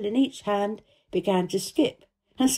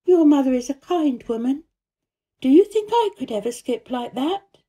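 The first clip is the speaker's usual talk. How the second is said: put-on a lifted, careful child; Mary's voice; 7 semitones higher, 4 dB louder than usual. A narrator's reading. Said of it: "she said".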